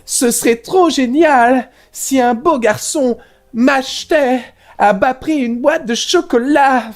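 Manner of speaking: put-on high, slow sugary girl voice.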